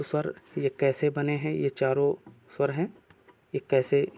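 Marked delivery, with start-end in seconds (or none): none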